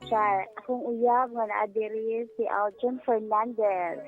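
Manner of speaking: usual speaking voice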